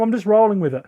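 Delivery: ordinary talk, nothing marked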